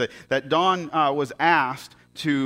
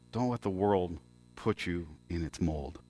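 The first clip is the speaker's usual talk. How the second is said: softer, lower, measured delivery; solemn retold speech; 4 semitones lower, 7 dB quieter than usual. Logger addressing the graduating class, hushed and solemn. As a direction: deliver it quietly and gravely, letting it land.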